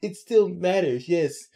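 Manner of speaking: high, insistent mock Beth; hammed-up insistent Beth; imitating Beth.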